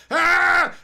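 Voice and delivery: loud, high imitated yell into a phone; hammed-up retold yell; mock yell into phone. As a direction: yell it like a startled medieval peasant. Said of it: "starts yelling into the phone".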